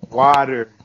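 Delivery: ordinary delivery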